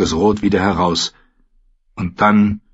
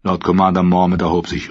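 ordinary delivery, nothing marked

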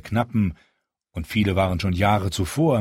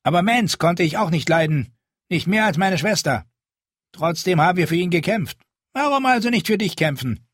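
gruff wildling; 9 semitones higher, 3 dB louder than usual. A narrator's reading. Halleck speaks to Jon.

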